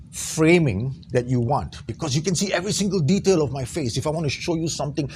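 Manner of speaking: normal talking voice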